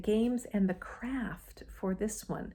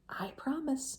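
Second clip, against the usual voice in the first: lightly voiced little crab; 6 semitones higher, 3 dB quieter than usual. A narrator's reading. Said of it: "said the little crab".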